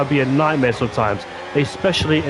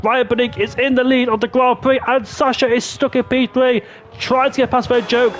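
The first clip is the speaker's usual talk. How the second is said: high-pitched, urgent race call; excited play-by-play; 10 semitones higher than usual. Real, not acted. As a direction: drive the commentary through a tense overtaking battle. Live call of a battle for lead.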